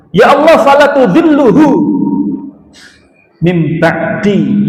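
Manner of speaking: loud, high, drawn-out pleading cry; fervent prayer. Acted. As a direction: plead to God for the friend left behind. Deceased praying for his friend. (doa memohon dengan suara tinggi dan keras)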